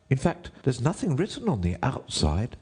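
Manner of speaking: high, deferential courtier. A narrator's reading. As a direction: address the king in a high rabbit voice.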